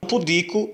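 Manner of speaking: "Pudico" stressed on the middle, pu-DI-co; "Pudico" with a strong second syllable; paroxytone stress. Shown as a demonstration. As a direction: hit the DI in "Pudico". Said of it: "uma palavra paroxítona".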